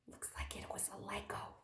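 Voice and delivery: soft whisper to listeners; whispered aside. Real, not acted. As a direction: whisper it like a secret.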